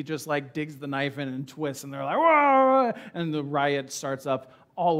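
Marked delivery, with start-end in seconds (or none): 2.2-2.9 sudden loud high gasp of shock; shocked whoa. Retold or imitated. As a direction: blurt it like a startled crowd. Crowd reacts to Paul's words.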